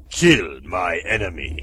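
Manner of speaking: low, slow sinister command; villain order. Acted. Master ordering robot to kill, low and slow.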